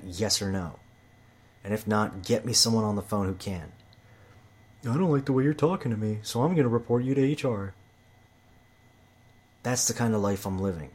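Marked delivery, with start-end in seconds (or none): 4.8-7.7 higher-pitched mimicry of an offended coworker; mock offended complainer. Threatening a report to HR, mock offended. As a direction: imitate someone taking offense, pitched up.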